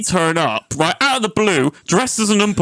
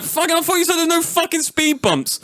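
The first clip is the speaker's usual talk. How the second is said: much higher, mock-indignant complaint; exasperated retort; 11 semitones higher than usual. Driver scolding his mate over the speed bump, high indignant squeak. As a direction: squeak it out in disbelief at your mate.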